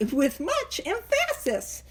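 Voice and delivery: "emphasis" with the stress jokingly on the middle syllable, em-FASS-is; misplaced stress. Shown as a demonstration. Speaker joking about emphasizing, "emphasis" stressed on the wrong syllable. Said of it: "did I put the correct emphasis on the right syllable".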